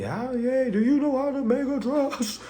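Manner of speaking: lightly voiced questioning folks; mock asker. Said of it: "Folks be asking me".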